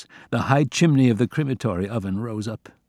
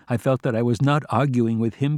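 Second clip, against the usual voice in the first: ordinary delivery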